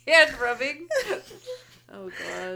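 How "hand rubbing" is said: high, loud, laughing "hand rubbing?"; laughing question. Real, not acted. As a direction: repeat it while cracking up. Laughing repeat of phrase.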